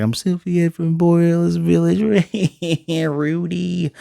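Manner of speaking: high, wailing imitation of tearful sobbing; mock crying. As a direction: put on a high, weepy wail. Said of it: "She's crying".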